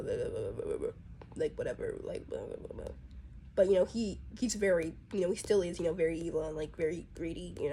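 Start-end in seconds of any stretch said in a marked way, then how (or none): 0.0-2.9 low, soft, droning blah blah blah; monotone voice. Shown as a demonstration. Greedy butterfly drones his blahs in a flat monotone. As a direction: drone on like a bored businessman. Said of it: "like, monotone voice".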